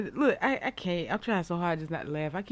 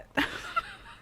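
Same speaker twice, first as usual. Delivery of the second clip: high laugh; 3 dB quieter than usual. Real, not acted. Host laughing mid-sentence.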